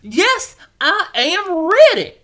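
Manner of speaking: put-on a child now keen to go; excited loud yes; eager and excited. Teacher asks, Tommy agrees eagerly.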